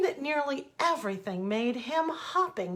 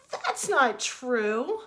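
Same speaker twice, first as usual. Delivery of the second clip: indignant; 4 semitones higher, 6 dB louder, much slower than usual. Acted. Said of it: "the young rabbit said".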